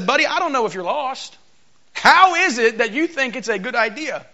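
mock the stranger who dared ask for water, rising into indignation; sassy Samaritan woman. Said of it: "that is not how it sounded".

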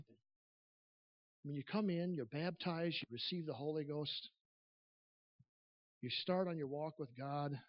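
usual speaking voice

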